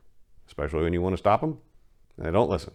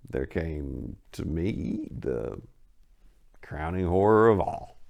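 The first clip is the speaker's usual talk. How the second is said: usual speaking voice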